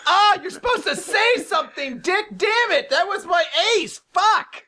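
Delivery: high-pitched cursing tantrum; hammed-up outrage. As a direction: play a comic fit of frustration. Acts out outraged character cursing.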